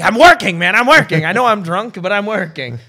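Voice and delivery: high, loud repeated insistence; exasperated protest. Retold or imitated. Comic to drunk friend wanting a hug, loud protest. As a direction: push back loudly, fending someone off.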